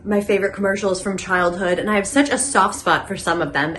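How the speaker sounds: ordinary talk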